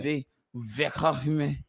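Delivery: lightly put-on Russian accent; slow, halting fake Russian accent. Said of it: "how was your Russian accent".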